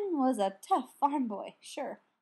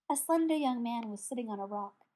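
usual speaking voice

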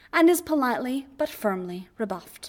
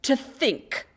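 short, higher snap; snapping; 5 semitones higher than usual. A narrator's reading. Obi-Wan snaps back at Anakin. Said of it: "Obi-Wan snaps back".

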